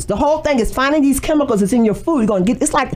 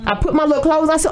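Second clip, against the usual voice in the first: usual speaking voice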